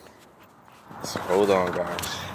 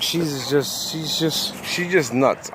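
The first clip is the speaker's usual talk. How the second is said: softer, panting, out of breath; 7 dB quieter, much slower than usual. Real, not acted. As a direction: gasp the words out between breaths. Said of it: "I'm out of breath".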